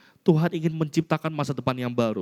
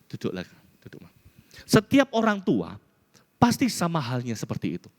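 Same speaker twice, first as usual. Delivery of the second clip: ordinary talk, nothing marked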